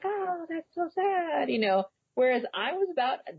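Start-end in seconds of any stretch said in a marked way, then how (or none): 0.0-1.5 high, slow mock pity; mocking fake sympathy. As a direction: sneer at hollow sympathy, drawing it out. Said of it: "people on social media are like".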